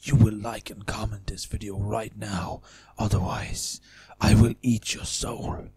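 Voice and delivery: slow, dragged-out villain lines before any effects; unedited menacing read. Shown as a demonstration. Raw take before editing for the audio tutorial. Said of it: "what it sounds like before we do any editing".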